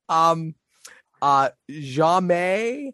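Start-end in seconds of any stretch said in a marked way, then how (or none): 1.2-2.9 jamais said slowly in French, zha-MAY; French word. Shown as a demonstration. Co-host coaxing a French word out of him, jamais slowly. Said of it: "hit us up with a little French".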